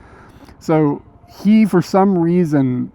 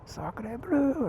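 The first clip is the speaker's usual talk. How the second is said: soft, high mock French accent; French exclamation; 10 semitones higher, 14 dB quieter than usual. Recalling the French streamer, soft mock French accent. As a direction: murmur it like a cartoon Frenchman. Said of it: "it's what it sounded like".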